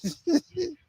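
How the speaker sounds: short high burst of laughter; laughing at the idea of the media hype; real laugh. Real, not acted. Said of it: "I'm laughing right now".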